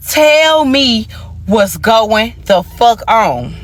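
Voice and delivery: drawn out, slow demand; slow twitching demand. Retold or imitated. Demanding the truth from her man, slow and shaken. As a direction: drag each word out, trembling. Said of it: "when I say I'm twitching".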